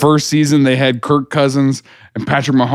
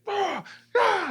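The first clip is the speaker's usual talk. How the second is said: high, much softer frustrated yelps; mock frustrated grunts; 12 dB quieter than usual. Imitating Mahomes after a dropped ball, soft high yelps. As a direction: toss out a pair of annoyed cries. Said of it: "the ball drops and he's like".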